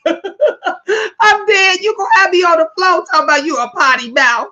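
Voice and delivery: high cackling laugh, words spilling fast; laughing at the viewer's potty mouth; real laughter. Real, not acted. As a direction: crack up and talk through the laugh. Cracking up at viewer.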